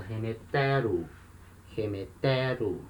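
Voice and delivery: slow model pronunciation, leaning on the TE of "Remetero"; accent shifted one syllable. Shown as a demonstration. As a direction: stress "Remetero" on TE, drawn out slowly. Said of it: "acento ando uma casa".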